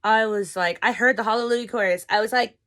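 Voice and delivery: usual speaking voice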